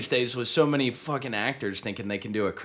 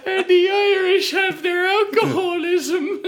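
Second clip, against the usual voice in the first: very high, loud squeal of laughter; slow line squeezed out through helpless laughter; hysterical laughing quote; 6 dB louder, slower than usual. Retold or imitated. Co-host finishing the movie joke, cracking up.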